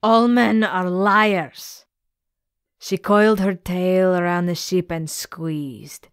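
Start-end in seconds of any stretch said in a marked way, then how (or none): 0.0-1.9 slow, otherworldly sea-monster pronouncement. Acted. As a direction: drag it out like an ancient sea creature. Gorgona's verdict.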